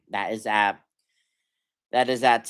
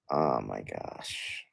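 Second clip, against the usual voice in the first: defeated groan; 13 semitones lower, 9 dB quieter, slower than usual. Real, not acted.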